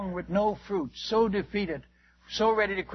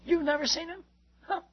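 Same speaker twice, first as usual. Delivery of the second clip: much higher, incredulous question; baffled; 10 semitones higher than usual. Retold or imitated. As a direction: sound utterly amazed he never saw it. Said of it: "He's baffled".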